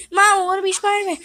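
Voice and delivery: put-on high, fast kid voice; eager little kid begging; little brother voice. Kid brother voiced asking mom.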